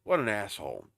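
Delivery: ordinary talk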